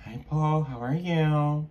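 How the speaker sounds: high, sing-song imitation of the boss. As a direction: chirp the greeting like an overly cheerful boss. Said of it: "He's like".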